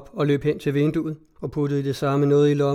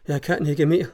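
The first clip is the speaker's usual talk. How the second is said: ordinary delivery, nothing marked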